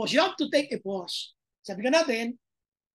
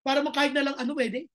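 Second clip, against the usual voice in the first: ordinary talk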